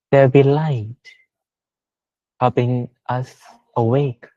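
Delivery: ordinary talk, nothing marked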